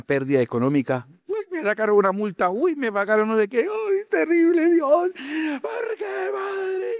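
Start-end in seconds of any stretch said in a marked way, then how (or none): 1.3-5.1 lamenting believer. Retold or imitated. Believer in trouble lamenting to God, high wail. 5.6-6.9 high, sobbing wail; mock wailing cry. Believer in trouble crying out to God in a hammed-up sob.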